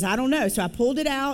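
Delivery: ordinary delivery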